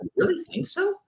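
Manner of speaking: high-pitched retold disbelief; mock disbelief. Answering people who called him strict, in high mock disbelief. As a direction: retell it as a high, incredulous question.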